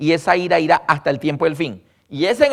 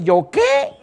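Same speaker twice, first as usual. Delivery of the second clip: very high, startled protest; indignant God. Retold or imitated. God protesting he is not to blame, high startled squeak. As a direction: squeak it like someone wrongly accused.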